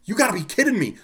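high, exasperated mock yell; retold yell. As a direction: yell it in disbelief. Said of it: "like, yelling at 'em".

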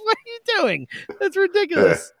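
high, disbelieving outburst; incredulous. Retold or imitated. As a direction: ask it in shocked disbelief. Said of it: "It's like".

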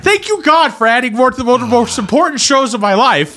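high-pitched mock thanks to God; sarcastic gratitude. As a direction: exclaim exasperated, hammed-up thanks. Sarcastic thanks for added stress.

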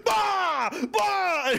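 high shouted grunts; battle grunts. Retold or imitated. Brock Lesnar smashing heads together, shouted grunts. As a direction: bark out fight noises. Acting out fight blows.

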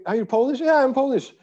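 two strangers discovering they're both Polish; excited surprise. Retold or imitated. Excited exchange between compatriots on slopes.

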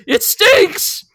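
high, loud mock wail; disgusted cry. Denji reacting to the unflushed toilet, high disgusted yell. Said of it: "And Denji says".

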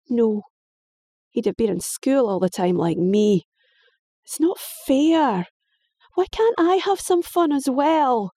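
high, sulky complaint; jealous daughter complaining to her father; whining girl. Acted. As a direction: stretch out it's not fair.

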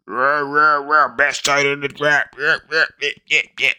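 high-pitched, yapping hype-man parody; mocking high squawk of hype; mock hype crowd. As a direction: chant like an overexcited draft fan, mockingly. Mocks hype crowd about Dalton Kincaid.

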